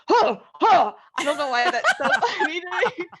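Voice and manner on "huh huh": louder, higher startled huh huh; startled gasps. Retold or imitated. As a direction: mimic a startled jump. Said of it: "he literally goes".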